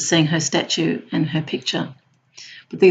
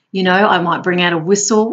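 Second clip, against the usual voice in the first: usual speaking voice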